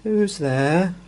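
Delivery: high, squeaky and glum; sad squeaky voice. Acted. Hidden stranger answering a knock, sad squeaky voice. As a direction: squeak it out sadly from behind the door. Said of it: "a sad, squeaky sort of voice".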